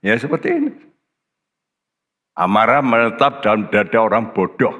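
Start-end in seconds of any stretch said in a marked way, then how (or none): 0.1-0.7 Ya seperti ini flung out much higher; much higher mock exclamation. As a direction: toss it up high, mocking the fool. Repeats after the verse. (seru mengejek dengan nada jauh lebih tinggi)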